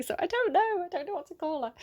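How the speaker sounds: high-pitched bewildered protest; exasperated confusion. Real, not acted. Reader giving up on a singer's name, exasperated and high. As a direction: pitch leaps up, at a loss.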